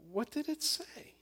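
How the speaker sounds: breathy whispered high question; inner voice. Acted. Pastor voicing God's inner voice, whispered. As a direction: whisper it like a voice inside. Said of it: "The voice continued".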